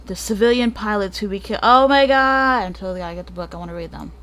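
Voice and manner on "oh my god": loud, higher, drawn-out "oh my God"; gushing, stretched-out exclamation; thrilled outburst. Real, not acted. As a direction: burst out mid-sentence with real excitement. Breaks off, thrilled by next stories.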